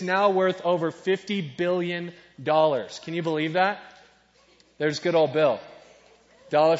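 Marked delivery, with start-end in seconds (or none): none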